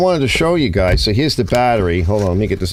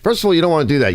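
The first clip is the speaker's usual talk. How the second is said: usual speaking voice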